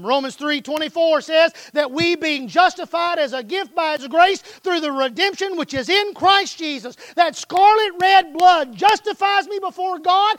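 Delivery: ordinary delivery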